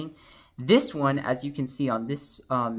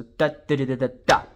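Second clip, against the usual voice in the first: da chant with the late da punched louder; accent on the and of two; 6 dB louder, much faster than usual. Shown as a demonstration. Teacher demonstrating the final accent, louder late da. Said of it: "the last accent is written on the and of two".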